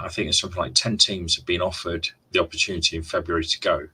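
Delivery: normal talking voice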